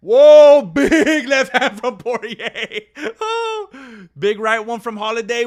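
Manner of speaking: sudden loud whoa breaking into a laugh; jolted by the exchange, laughing; real surprise with a laugh; excited whoa. Real, not acted. Live reaction to big punches.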